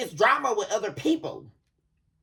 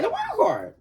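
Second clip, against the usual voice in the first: high, laughing squeal on world card; excited laughing shout; 13 semitones higher than usual. Real, not acted. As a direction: shriek world card in delight. Shocked by drawing the World card.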